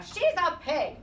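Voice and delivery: indignant insistence. A narrator's reading. Eugenia correcting the others, loud and exasperated. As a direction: put her foot down.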